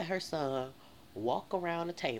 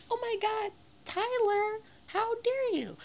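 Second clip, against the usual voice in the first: much higher, louder mock-appalled mom; shocked mother; 7 dB louder, slower than usual. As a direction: shriek it like a scandalised mother. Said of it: "And so the mama was just like".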